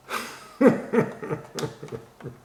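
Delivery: high, wheezy burst of laughter; real laugh. Real, not acted. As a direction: crack up at your own joke. Cracks up at own joke.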